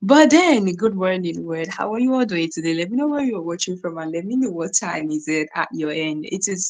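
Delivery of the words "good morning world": ordinary talk, nothing marked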